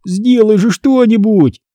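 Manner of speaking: high-pitched, distressed begging; pained, high whimpering appeal; whining plea. A narrator's reading. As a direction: whine for help. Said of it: "заскулил Карло".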